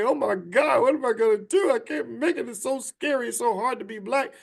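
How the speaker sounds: high-pitched mocking panic; mock freak-out. Mocking panicking listeners, hammed-up high fear. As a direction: parody someone freaking out, voice pushed up high. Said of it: "wanna freak out and say".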